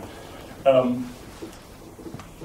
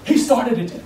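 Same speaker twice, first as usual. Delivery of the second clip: sheepish excuse; 9 semitones higher than usual. Retold or imitated. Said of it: "both of them take a step back and go".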